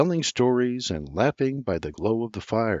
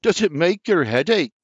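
higher, louder mockery; mock her thinking; R J ribbing Sarah; teasing jab; 7 semitones higher, 4 dB louder than usual. A narrator's reading.

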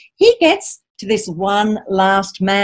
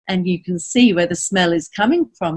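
usual speaking voice

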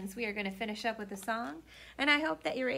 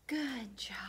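whispered praise; soft whisper; 4 dB quieter than usual. Real, not acted. Librarian whispering praise to listening children.

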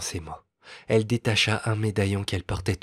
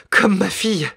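high, loud burst of hope; sudden excited exclamation; eager cry; 11 semitones higher, 7 dB louder than usual. Acted. Young woman hopeful about lost child.

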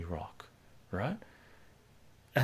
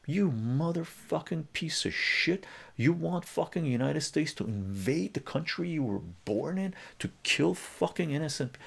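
retold angry tirade; 7 semitones higher than usual. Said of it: "I loaded on him".

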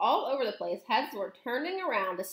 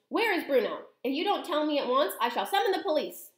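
higher, heated demand; angry cry; 7 semitones higher, 4 dB louder than usual. A narrator's reading. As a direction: threaten her in a raised, indignant voice. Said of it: "cried Mister Jenkins".

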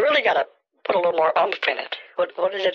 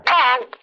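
loud honking goose call; 7 semitones higher, 5 dB louder than usual. Shown as a demonstration. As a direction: honk it out. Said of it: "Do you see the difference?".

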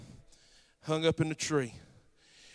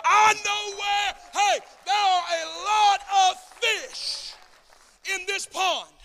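preaching shout; 11 semitones higher, slower than usual. Real, not acted. Pastor shouting to the church about fish. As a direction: call it out over the congregation.

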